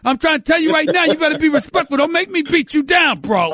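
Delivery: high, loud mock tough-guy threat; playful threat. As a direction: bark it out half-laughing.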